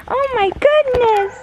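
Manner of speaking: high, slow, squealing delight; excited squeal. Real, not acted. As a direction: squeal at the cute dogs. Sees the puppies.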